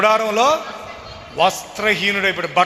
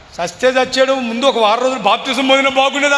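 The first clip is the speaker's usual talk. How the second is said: louder, higher protest; pleading; 7 semitones higher, 5 dB louder than usual. Retold or imitated. Son explaining to mother, loud pleading protest. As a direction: push the words out in youthful distress. Boy answering mother, upset.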